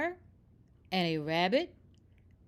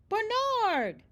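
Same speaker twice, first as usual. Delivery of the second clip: calling out; 12 semitones higher, 3 dB louder than usual. Acted. Animals calling out to Bernard.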